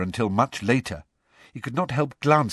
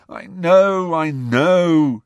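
miserable; 9 semitones higher, 6 dB louder, much slower than usual. A narrator's reading. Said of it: "said Angelina miserably".

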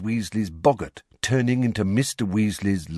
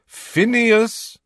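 loud, high shout of the name; 14 semitones higher, 7 dB louder than usual. A narrator's reading. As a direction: yell to wake a sleeper. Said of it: "when he attempted to rouse him".

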